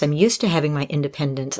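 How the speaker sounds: ordinary talk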